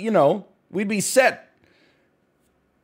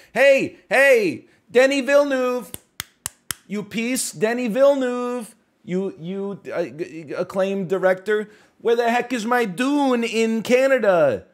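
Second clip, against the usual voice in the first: mock calling out; 8 semitones higher, 4 dB louder than usual. Calling out a director.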